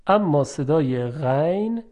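usual speaking voice

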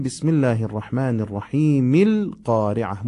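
الرحیم القارعه run together as ilqariʕah with the hamza dropped; joined recitation. Shown as a demonstration. Teacher joining the basmala to القارعه in one breath. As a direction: link الرحیم straight into القارعه. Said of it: "ولی اگه وصل کنیم".

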